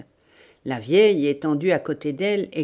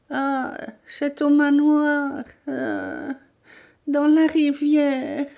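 put-on high, slow, agonised old woman; pained confession; 10 semitones higher, slower than usual. Tortured old woman confessing to the bandit, in agony. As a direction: drag the words out through pain.

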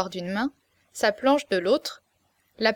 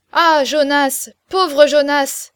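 loud, higher, very slow lament; chorus crying out; 4 semitones higher, 8 dB louder, slower than usual. A narrator's reading. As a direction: draw out the pity loudly. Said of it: "cria le chœur des marchands".

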